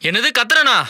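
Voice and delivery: husband yelling at wife about the transfer, angry; angry outburst. Acted. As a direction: blow up at her over the money. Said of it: "இதுக்கு தான் இவ்ளோ கோவமா?".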